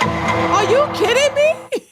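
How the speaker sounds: are you serious, high and drawn out; incredulous. Real, not acted. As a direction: stretch it out, can't believe it. Outraged reaction to quoted claim.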